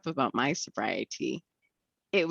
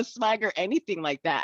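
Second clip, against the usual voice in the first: ordinary talk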